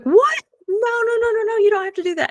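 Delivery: a stranger refusing a gift in a flustered rush; imitating a flustered stranger; shocked protest. Woman protests shirt offer.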